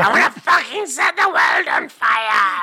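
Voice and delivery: slow, high hammed-up shout; mock fiery yell. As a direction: belt it out like an overconfident diva. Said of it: "'Cause you're like".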